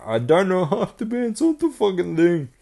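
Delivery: high-pitched mock whine; mocking complaint. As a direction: imitate a whingeing complainer. Mocking people who complain about lineup.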